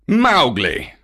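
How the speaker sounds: loud, falling rebuke; angry. Acted. Panther scolding the laughing boy, angry. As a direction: cut the boy off with his name. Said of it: "said Bagheera angrily".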